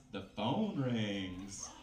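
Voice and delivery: ordinary talk, nothing marked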